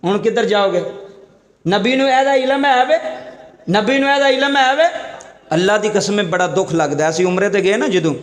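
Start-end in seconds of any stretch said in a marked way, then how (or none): 1.7-2.9 raised, scornful mock question. Mocking the doubter's question. 3.7-4.8 higher pitched scornful ਵੇ rising; mocking question.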